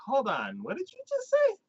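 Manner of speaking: sudden high-pitched squeak of confusion; baffled squeak. Retold or imitated. Viewer lost by fast jokes, squeaking in confusion.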